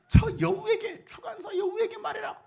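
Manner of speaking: a playful, giggling jab at the king; laughing mock quote. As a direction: laugh while calling him a sly fox. Mocking retelling of Jesus calling Herod fox.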